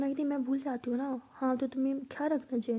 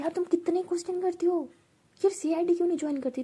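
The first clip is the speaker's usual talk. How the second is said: higher, louder playful complaint; mock exasperation; 6 semitones higher, 5 dB louder than usual. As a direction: protest in a raised voice, speeding up. Teasing friend for endless questions. (ऊँची आवाज़ में, झुंझलाहट जताते हुए)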